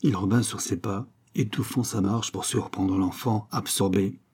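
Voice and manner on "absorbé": plain reading voice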